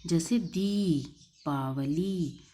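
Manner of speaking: दीपावली with each long ई drawn out and held. Shown as a demonstration. Teacher shows children how long ई is read. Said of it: "बड़ी की मात्रा थोड़ा देर से पढ़ते हैं".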